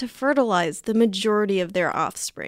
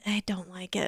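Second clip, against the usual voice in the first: whispering; 3 semitones lower, 10 dB quieter than usual. Real, not acted. Confiding mayonnaise dislike.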